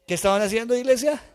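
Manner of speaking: high, loud call to the church. Real, not acted. Preacher rallying his church, high and loud. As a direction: rouse the congregation to answer.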